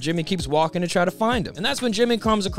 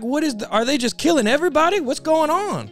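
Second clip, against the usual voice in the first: alarmed rising voice; 7 semitones higher than usual. Acted. Jimmy alarmed at fighting.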